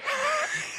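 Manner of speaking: real burst of high laughter; sudden high giggle; high squeal of laughter. Real, not acted. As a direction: crack up at your own joke. Laughs at own joke.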